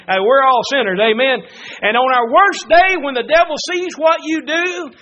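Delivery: loud, high-pitched revival preaching; fiery preaching. Acted. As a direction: preach it out to the whole church. Preaching builds to full force.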